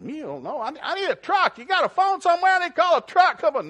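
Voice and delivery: high, loud pleading city guy; frantic coach. Retold or imitated. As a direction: beg for a truck, exasperated. Stuck city coach pleading loudly.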